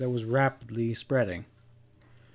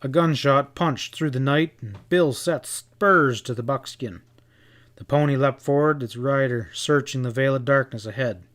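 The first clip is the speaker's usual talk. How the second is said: ordinary delivery, nothing marked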